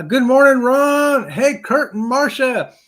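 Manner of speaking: high, loud, stretched good morning; drawn-out, high shout-out; calling out greeting. Real, not acted. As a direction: hail viewers with a big shout.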